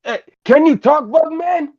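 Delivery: very high puppet voice calling out; excited puppet character. Acted. Puppet calling to the bug man, high and excited. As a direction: pipe up eagerly in a tiny high voice.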